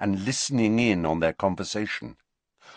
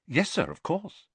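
high, prompt, compliant reply; quick reassurance; 8 semitones higher than usual. A narrator's reading. Pupil promptly reassures uneasy professor, quick. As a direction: agree at once, lightly. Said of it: "said Riddle quickly".

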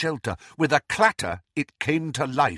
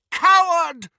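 much higher, louder shout; 9 dB louder than usual. Acted. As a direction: yell it out even harder. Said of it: "Harry heard McGonagall cry".

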